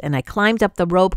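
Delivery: ordinary delivery, nothing marked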